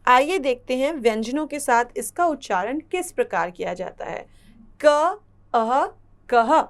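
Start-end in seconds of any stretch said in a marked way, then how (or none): 4.8-5.8 क then अह, each drawn out slowly; spelled-out sounds. Shown as a demonstration. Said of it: "इसको हम बोलेंगे कह". 6.3-6.6 कह said loud and crisp with a breathy ह; clear demo. Shown as a demonstration. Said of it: "इसको हम बोलेंगे कह".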